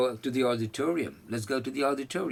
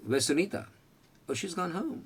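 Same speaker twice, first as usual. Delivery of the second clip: acted office chat; 8 semitones higher, slower than usual. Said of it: "किसी ने पूछा".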